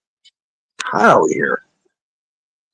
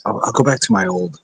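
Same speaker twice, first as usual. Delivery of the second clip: ordinary talk, nothing marked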